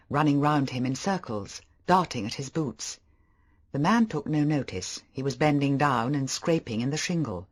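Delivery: plain reading voice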